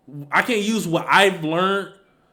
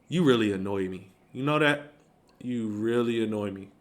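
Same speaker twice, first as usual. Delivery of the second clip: low, soft and controlled annoyance; calm angry tone; 4 semitones lower, 4 dB quieter than usual. Shown as a demonstration. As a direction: say it quietly but mean it. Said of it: "I can still be angry at you with a calm tone".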